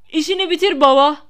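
excited shout from the sidelines; shouted egging-on. A narrator's reading. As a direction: yell encouragement to your father. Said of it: "diye bağırdılar".

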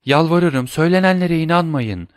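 high, begging plea; terrified wife begs her husband; pleading. Acted. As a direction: beg him not to believe it.

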